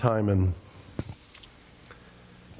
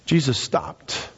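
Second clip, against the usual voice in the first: slow, weighty delivery; measured statement; 3 semitones higher, much slower than usual. Real, not acted. Preacher telling the turning point, slow and measured. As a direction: let each word land slowly.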